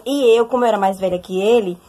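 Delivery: ordinary talk, nothing marked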